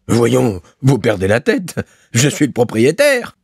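high, affronted remonstrance; indignant protest. A narrator's reading. Landlord protesting to the bailiff who orders him out, indignant. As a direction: protest with rising outrage.

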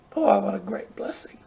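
hushed awed whisper. Real, not acted. Preacher marvelling at a mother's love, whispered. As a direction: breathe it in quiet wonder.

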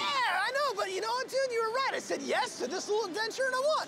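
high, loud gloating; excited gloating. Acted. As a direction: crow about winning. Gloating over the win.

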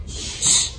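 "sh" as a soft voiceless hush; isolated first sound. Shown as a demonstration. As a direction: hold "sh" alone, no vowel. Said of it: "First sound".

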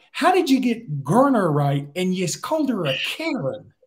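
high, drawn-out incredulous question; mock disbelief. Chris Doering questioning his partner's name blunder, mock incredulous.

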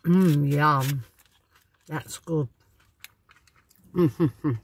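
chewing while talking; speaking through a bite of food; mouth full. Real, not acted. Seen 1: bites food at -2, visibly chewing through the labelled words.

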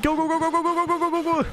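frantic high "go go go" chant; panicking gamer. Real, not acted. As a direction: urge yourself on while fleeing. Fleeing monster in game.

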